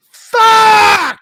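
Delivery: a huge, high yelled curse; shocked shout. Retold or imitated. Husband caught with a hidden stash, yelling in shock. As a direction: blurt it out like you just got caught. Retold shocked reaction to wife's text.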